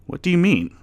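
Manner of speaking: high, loud worried question; alarmed question. A narrator's reading. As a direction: ask in rising alarm. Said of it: "he asked".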